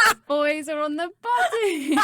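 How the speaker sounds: high, loud delighted gushing; excited squeal. Real, not acted. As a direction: squeal it over a cute photo. Thrilled reaction to a photo message.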